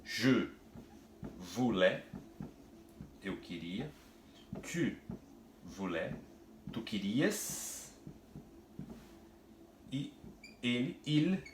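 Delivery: je voulais with long pauses, /vulɛ/; drawn-out vouloir, each word set apart; slow French demo. Shown as a demonstration. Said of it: "a gente conjugaria ele assim, ó". (demonstração lenta de je voulais)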